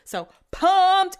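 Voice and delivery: high, loud burst of excitement; real giddy enthusiasm; really excited. Real, not acted. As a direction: punch it out, pumped up. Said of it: "I'm just really excited".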